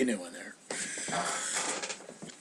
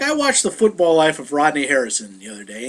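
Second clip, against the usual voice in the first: normal talking voice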